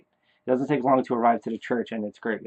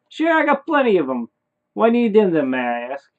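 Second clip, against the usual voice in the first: hammed-up high, loud neighbour; Bob; 8 semitones higher, 6 dB louder than usual. Neighbour agrees to lend candles, exaggerated high loud voice. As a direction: start very high and loud, drop at the end. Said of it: "what Bob would say in my head".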